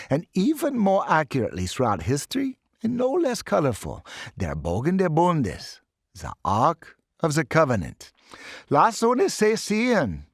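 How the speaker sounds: put-on professor in a lightly done German accent; bad German accent. Professor shows the sword to his class, German-accented. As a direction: lecture with a theatrical German lilt. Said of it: "bad accent".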